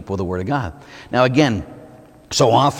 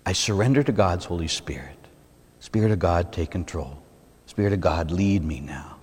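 soft, low prayer spoken to God; hushed prayer; 6 semitones lower, 6 dB quieter than usual. Shown as a demonstration. Priest shows his congregation a hushed prayer. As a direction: pray it quietly, surrendering.

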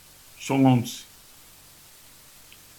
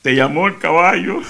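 louder punchline breaking into a laugh; 4 semitones higher, 6 dB louder than usual. Retold or imitated. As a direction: deliver it as you crack up. Punchline of the joke, wife speaking.

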